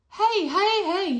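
put-on old man hailing his friend below; yelled. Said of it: "he yelled".

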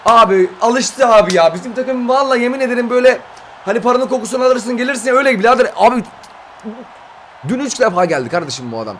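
high-pitched complaining; exasperated rant. Real, not acted. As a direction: vent to a friend in disbelief. Ranting about the same player. (isyankâr sitem)

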